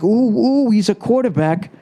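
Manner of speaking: high, mocking fan gush about a running quarterback. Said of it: "they had to be like".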